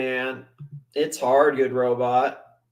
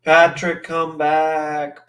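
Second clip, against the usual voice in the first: ordinary talk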